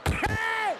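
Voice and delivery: high, alarmed shout of Hey; sudden yell. Retold or imitated. Passenger startled at the driver gunning it, yelling. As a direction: yelp it as you sock the driver.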